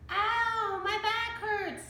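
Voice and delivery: high sing-song whining; cried out. A narrator's reading. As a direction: moan about his sore back. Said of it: "Stinky cried".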